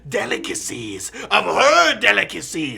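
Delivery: high, loud, slow theatrical villain rant; mustache twirling villain. Acted. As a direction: proclaim like a mustache-twirling fop. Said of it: "mustache twirling type voice".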